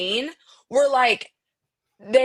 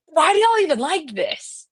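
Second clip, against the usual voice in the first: high, incredulous parental question; retold disbelief; 8 semitones higher than usual. Friend retelling reaction to kids' video, incredulous. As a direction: re-enact baffled dismay, pitched up high.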